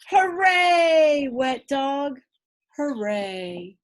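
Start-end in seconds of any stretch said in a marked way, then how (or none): none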